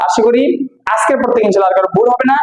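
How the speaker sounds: normal talking voice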